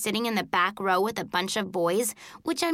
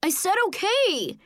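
high, exasperated retort; yelling back; 10 semitones higher than usual. A narrator's reading. Joey answering the scolding girl, yelling back. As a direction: yell back in protest. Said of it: "Joey yelled back".

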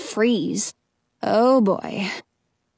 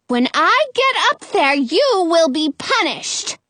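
irate witch, pitched up and slowing; hollering; 6 semitones higher than usual. A narrator's reading. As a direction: holler a threat up to the window. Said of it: "Frau Gothel hollers".

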